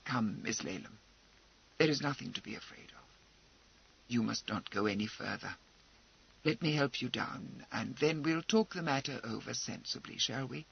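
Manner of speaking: put-on calm a frightened girl gently; grave kindness. A narrator's reading. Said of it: "said gravely, but with great kindness".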